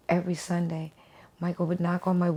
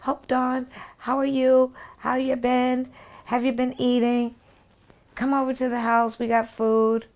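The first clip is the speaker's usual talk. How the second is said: imitating Michael's greeting; 7 semitones higher, 3 dB louder than usual. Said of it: "And he says".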